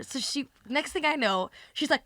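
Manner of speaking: ordinary delivery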